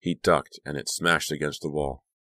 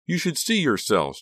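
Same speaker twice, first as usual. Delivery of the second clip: high, angry outburst; pitched-up, outraged yell; screaming; 11 semitones higher, 4 dB louder than usual. A narrator's reading. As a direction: scream it at the whole group. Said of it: "Danielle screamed".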